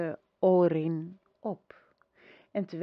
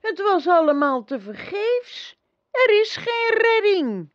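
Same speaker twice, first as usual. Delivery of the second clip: high, loud, tearful outburst; despairing cry; 13 semitones higher, 10 dB louder than usual. A narrator's reading. Atreyu tells the empress all was in vain, in a loud tearful cry. Despairing boy after failed quest.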